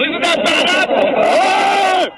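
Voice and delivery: dragged out, slow, warped playback; slowed-down edit. Acted. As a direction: stretch every word like a slowed tape. Remix edit repeats the line slowed.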